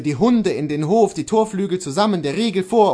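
ordinary delivery, nothing marked

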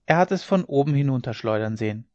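ordinary delivery, nothing marked

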